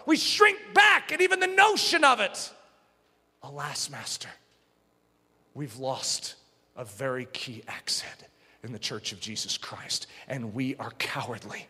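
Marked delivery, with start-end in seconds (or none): 3.4-11.7 soft, mournful lament over the church; hushed lament. Real, not acted. Preacher mourning a cowardly church, hushed and sorrowful. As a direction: grieve it quietly, almost to yourself.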